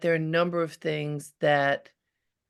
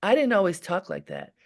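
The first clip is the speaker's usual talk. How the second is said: normal talking voice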